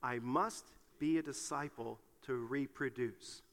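slow, low and soft recitation; measured, subdued lead for others to repeat. Shown as a demonstration. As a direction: say it slowly and softly for everyone to follow. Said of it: "would you say that with me".

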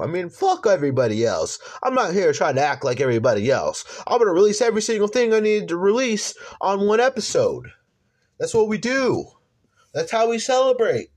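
loud, high hype-man energy; hype announcer. Real, not acted. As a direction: pump up the crowd, ladies and gentlemen. Host boasts about releasing everything.

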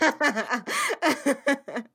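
high burst of laughter; laughing. Real, not acted. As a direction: laugh out loud at the joke.

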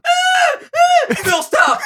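high panicked yelling; mock panicked shout. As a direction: shriek at Phil to stop. Acting out the clip scene.

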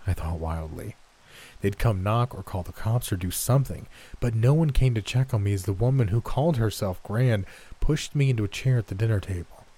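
ordinary delivery, nothing marked

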